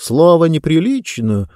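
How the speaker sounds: ordinary delivery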